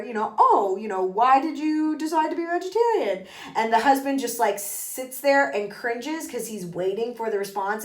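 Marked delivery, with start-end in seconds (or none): 0.4-3.3 high, put-on polite curiosity; mock-interested society voice; nosy dinner guest. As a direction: imitate a fake socialite asking a question. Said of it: "so they ask her".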